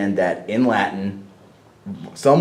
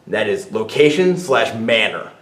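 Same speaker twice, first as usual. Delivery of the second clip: ordinary delivery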